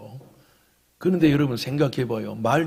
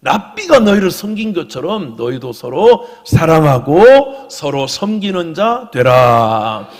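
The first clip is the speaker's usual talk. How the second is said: loud, high-pitched exhortation in swelling waves; full-force preaching; 6 semitones higher, 14 dB louder than usual. Real, not acted. Pastor exhorts the congregation to follow Jesus, impassioned loud preaching. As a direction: preach it out loud, stretching the key phrases.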